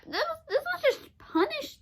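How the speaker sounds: high laughing disbelief. Real, not acted. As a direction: laugh through the outrage. Laughing mid-rant.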